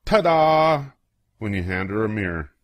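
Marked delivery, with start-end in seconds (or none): 0.1-0.9 high, loud showy ta-da; shout. Acted. Child shows grandma the mirror with a loud ta-da. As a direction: present it with a flourish.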